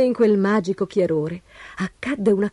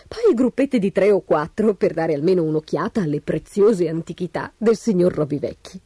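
usual speaking voice

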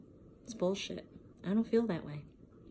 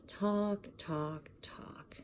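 each word softer until whispered; fading to nothing; 2 semitones higher, 5 dB quieter, much slower than usual. Acted. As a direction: let it die away to a whisper. Fading repetition.

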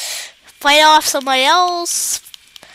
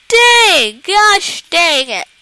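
frustrated outburst; 2 semitones higher, 5 dB louder, slower than usual. Real, not acted. Gamer just lost the match, frustrated yell.

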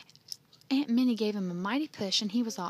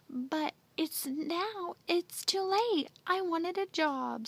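high, slow tearful plea; a little boy sobbing it out, much higher and slow; choked-up child begging through tears; 8 semitones higher, slower than usual. A narrator's reading. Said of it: "sobbed Andy".

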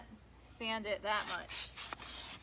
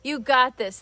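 reading comment aloud loudly; 13 dB louder than usual. Real, not acted. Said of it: "What are you all saying?".

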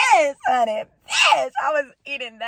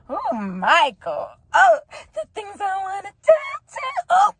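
excited squeal; 6 semitones higher than usual. Real, not acted. Fan swooning over Michael, squealing higher and higher. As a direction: voice leaping high into a squeal.

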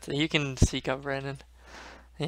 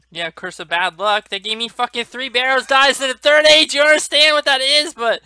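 building from raised voice to high shouting; incredulous rant, louder and higher as it goes; exasperated rant; 8 semitones higher, 8 dB louder than usual. Real, not acted. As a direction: climb steadily into a high yell. Ranting at the irony of the loss.

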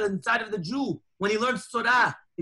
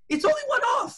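high pleading; faster than usual. Retold or imitated. Congregant pleading about the flight.

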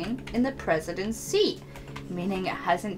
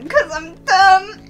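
self-mocking whine; 11 semitones higher, 10 dB louder, slower than usual. Real, not acted.

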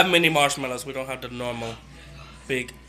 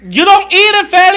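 laughing shout; 14 semitones higher, 13 dB louder than usual. Real, not acted. Yelling at brother, laughing.

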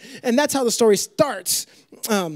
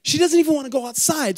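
ordinary talk

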